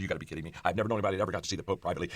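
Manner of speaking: low, soft incredulous mutter; retold barber's quiet, quick amazement; hushed disbelief. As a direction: rush it out under your breath, stunned.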